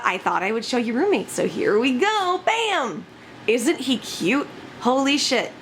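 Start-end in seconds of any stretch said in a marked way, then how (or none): none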